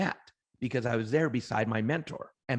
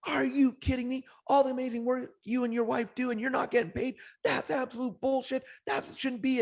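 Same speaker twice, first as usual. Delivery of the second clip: much higher, indignant disbelief; outraged rant; 11 semitones higher than usual. Retold or imitated. A retold, hammed-up outrage, pitched way up. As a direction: retell a volunteer's outburst at being unpaid, high-pitched indignant rant. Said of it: "the guy flipped out".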